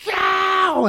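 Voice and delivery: high, slow mock shriek; mock scream. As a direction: shriek it like a hysterical protester. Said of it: "screaming at the cop".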